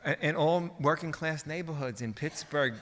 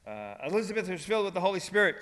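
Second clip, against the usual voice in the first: ordinary talk, nothing marked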